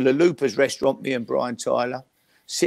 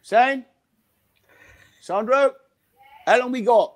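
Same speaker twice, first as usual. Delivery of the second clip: calling across the house, raised and higher; 9 semitones higher than usual. Real, not acted. [name] calling to Sandra about dinner, raised voice. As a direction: shout to someone in another room.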